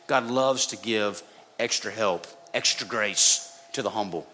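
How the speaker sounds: usual speaking voice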